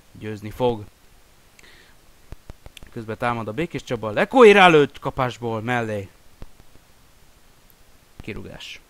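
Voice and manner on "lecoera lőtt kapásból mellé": excited commentary. Real, not acted. Shot goes wide.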